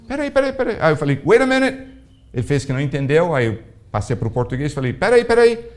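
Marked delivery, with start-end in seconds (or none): 0.1-0.8 calling wait. Retold or imitated. Calling after the leaving man. 1.2-1.9 calling out. Retold or imitated. Calling after him in English. 4.9-5.7 calling wait. Retold or imitated. Calling after him again.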